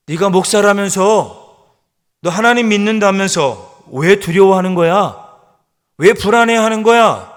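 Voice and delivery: loud, high, rebuking voice; scolding his own soul. Acted. Preacher rebuking his own soul, loud and high. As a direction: shout down your own doubting soul. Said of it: "자기 스스로 자기 영혼을 향하여 대화하고".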